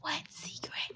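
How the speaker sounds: hushed, unvoiced whisper. Kid asking mom about the secret, whispering. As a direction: whisper it secretively under your breath. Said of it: "I whispered".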